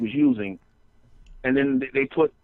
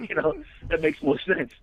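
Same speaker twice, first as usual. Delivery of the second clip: ordinary talk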